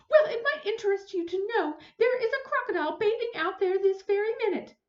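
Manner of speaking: high, loud huffy announcement; smug indignation. Acted. Neighbor telling the lifeguard about the crocodile, high and huffy. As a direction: point it out triumphantly. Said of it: "Missus Hipple pointed".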